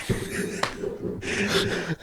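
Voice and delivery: high unvoiced burst of laughter; high breathy laugh. Real, not acted. Big laugh at wife joke.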